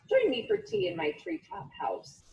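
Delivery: a genteel owl asking the mouse to tea; sweet high owl. Acted.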